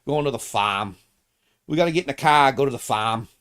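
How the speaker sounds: mock Boston accent, r dropped in farm; lightly put-on Boston accent, "farm" as "fahm". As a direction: imitate a Boston local saying "fahm". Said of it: "they sound a little weird".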